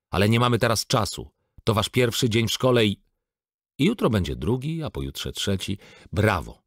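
exasperated. A narrator's reading. Father hurries his daughters to school, exasperated and loud. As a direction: hurry them along, losing patience.

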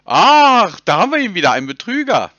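triumphant loud outburst. Real, not acted. Streamer calling out the scammer, triumphant loud outburst. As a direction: bust him out loud, gleeful.

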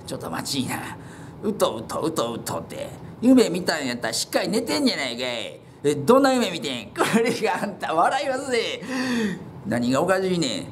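the elder cutting him off; exasperated elder. Acted. Elder character voice.

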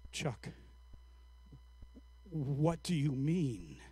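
slow, soft and low; hushed, careful question. Retold or imitated. Interviewer to the weeping old man, hushed and slow. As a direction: ask gently, leaving a long pause after the name.

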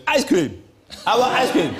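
high, loud imitation of a demanding child; shouting four-year-old. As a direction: yell it like a toddler with no manners. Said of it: "he thinks you have to shout".

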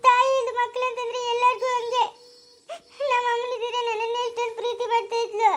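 high, grieving wail; tearful, self-pitying lament in a high voice; crying. Acted. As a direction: weep for a lost mother. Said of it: "ಹಂಗೆಲ್ಲ ಅಳ್ಬಾರ್ದಮ್ಮ".

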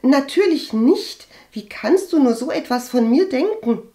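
high, loud, offended protest; pouting. A narrator's reading. Cousin denies spreading the rumour, pouting. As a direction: protest with a sulky pout. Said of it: "verzog ihr schmollendes Puppengesicht".

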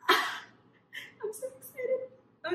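high, slow, laughing squeal of joy; laughing with excitement. Real, not acted. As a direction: squeal it through a giggle. Laughs over the special edition.

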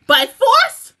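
high, loud incredulous question; shocked disbelief. Real, not acted. Viewer stunned by a brutal attack, shocked disbelief.

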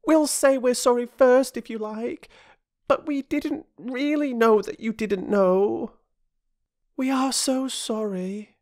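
put-on very high, childlike pleading; sorry, high-pitched child; apologetic little girl. A narrator's reading. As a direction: apologise earnestly in a small child's voice.

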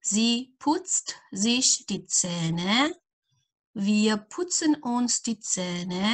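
each pronoun and verb spaced out slowly; slow conjugation drill. Acted. Teacher drilling a verb table for students, slowly. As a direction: chant the table slowly for learners.

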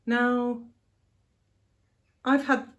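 normal talking voice

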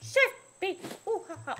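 teasing chant with mock laugh; taunting sing-song. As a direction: jeer it like a playground taunt.

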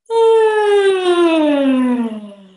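long, loud yawn sliding down from high; demonstrated open-mouthed yawn, drawn out; big loud yawn. Shown as a demonstration. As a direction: let out a huge noisy yawn. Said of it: "Do a really loud sound of a yawn".

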